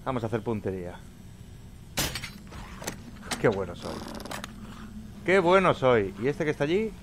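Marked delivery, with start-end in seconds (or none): 5.2-6.1 higher and louder, bragging about his aim; boastful cheer. Real, not acted. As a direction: crow about the shot with a loud high boast. Self-praise after a good shot. (presumiendo de su puntería, alto y agudo)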